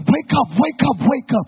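high, loud cries to rouse someone; panicked shouting. Retold or imitated. Frightened passenger trying to rouse his pilot friend, panicked shouting. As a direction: act a desperate yell at an unconscious friend. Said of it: "He went back to his friend".

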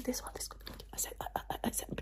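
soft whisper with stuttered repeats; halting whisper. Pretend. Doctor asking patient about colours, whispered. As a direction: whisper it in fragments. Whispered ASMR sound questions.

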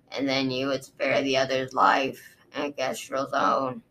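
low, measured guard recounting; a dragon guard explaining to his queen, low and sober; Osprey, low and grave. Acted. Osprey voiced in story.

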